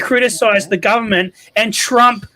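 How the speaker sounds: high-pitched, incredulous protest; heated objection. Real, not acted. Defending a fired actress to the panel, heated objection.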